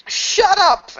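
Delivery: very high, loud shriek; piercing, drawn-out yell; scream. Acted. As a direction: scream it to silence your tormentor. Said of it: "And she just screams that".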